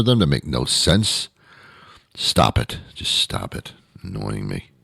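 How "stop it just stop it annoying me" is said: low, hushed mock scolding; quiet, low comic irritation; annoyed muttering. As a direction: grumble it under your breath. Mock scolding over pickles, winding down.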